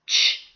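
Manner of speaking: ch said as a short crisp ch; ch sound. Shown as a demonstration. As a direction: sound out ch clearly. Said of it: "makes the sound".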